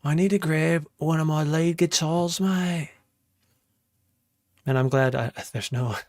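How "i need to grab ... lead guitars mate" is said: put-on Aussie accent, lifting on the last word; Australian accent. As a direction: ham it up as an Australian. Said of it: "I really love the Australian accent".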